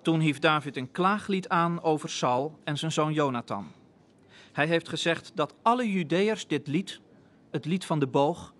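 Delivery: ordinary delivery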